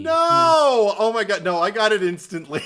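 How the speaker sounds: high, shouted protest; loud disbelief. Real, not acted. Speaker insists to co-host they got it instantly, loudly incredulous. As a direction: cry out a high, drawn NO, then keep it loud. Disbelief at co-host's late realization.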